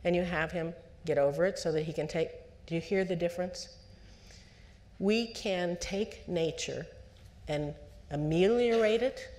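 ordinary delivery

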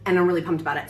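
usual speaking voice

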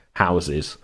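normal talking voice

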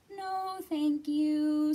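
high, loud, long-drawn squeak; squeaking Little Mouse. A narrator's reading. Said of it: "squeaked Little Mouse".

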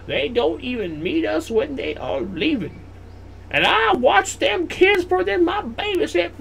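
high-pitched, hammed-up old granny voice; grumbling grandma. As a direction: read the note as a squeaky, cranky grandmother. Reads Grandma's notes in character voice.

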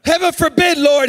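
high, emphatic outcry; loud exclamation. Acted. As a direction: cry it out with an exclamation point. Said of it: "the reason I said it loud like that".

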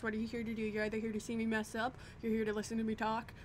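ordinary talk, nothing marked

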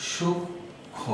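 সূক্ষ্ম whispered as shukkhõ, no m sound; সূক্ষ্ম with a doubled kh and nasal o, breathed softly; correct pronunciation. Shown as a demonstration. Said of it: "এটির উচ্চারণ হবে".

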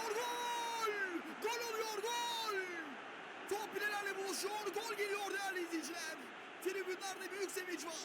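high shouted goal call; excited goal call. Real, not acted. Second goal called.

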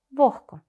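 «Вогко» with г softened toward х; devoiced h. Shown as a demonstration. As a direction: model «Вогко» as VOKH-ko. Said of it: "наближається у звучанні до глухого «х»".

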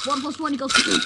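loud, high, hurried sum; quick, forceful recital of a sum; loud repeat. Acted. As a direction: blurt the sum out at full volume. Louder repeat of mock lesson.

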